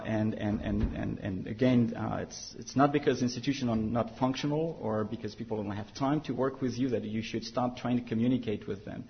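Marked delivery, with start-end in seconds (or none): none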